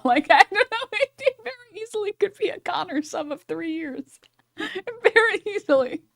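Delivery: high, squeaky laughing voice; real helpless laughter. Real, not acted. Cracking up over the chat message.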